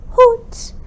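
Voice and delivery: high, hooting owl call. Pretend. Teacher hooting like the owl in the rhyme. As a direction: hoot like a little owl.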